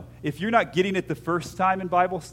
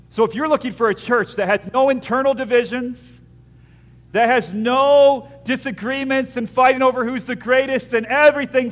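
rising, louder preacher's call; preaching; 9 semitones higher, 9 dB louder than usual. Acted. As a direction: press the congregation hard. Preacher building to a point.

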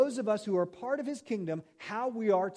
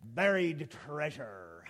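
pirate voice; 5 semitones lower, much slower than usual. Pretend. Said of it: "the last time I'm gonna do the pirate voice".

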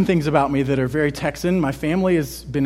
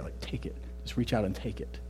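hushed, breathy whisper of an invitation; soft urgent whisper, pulling them close; 5 semitones lower, 15 dB quieter than usual. Acted. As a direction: lean in and whisper it like a secret. Urging listeners to grasp kingdom.

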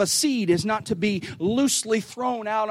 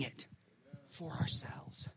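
whisper; 3 semitones lower, 18 dB quieter, slower than usual. Real, not acted. Quiet drop at sermon point.

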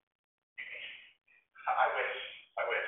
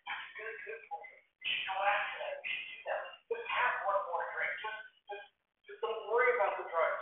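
soft, mostly higher, half-whispered coaxing; tempting Satan; 5 semitones higher, 4 dB quieter than usual. Acted. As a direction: tempt like a sly voice inside the head. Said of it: "I can hear Satan in my head".

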